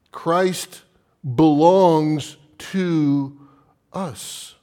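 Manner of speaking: BELONGS drawn out loud, each word set apart; slow, weighty stress on BELONGS, pause before "us"; emphatic preaching. Real, not acted. Preacher driving the point home to the church, slow and emphatic.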